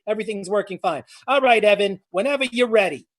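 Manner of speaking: ordinary talk, nothing marked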